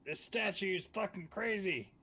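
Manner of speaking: high, loud in-character yell; shouting back. Acted. Player reporting charmed friends to Casimir, shouting back. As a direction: yell the news back across a distance.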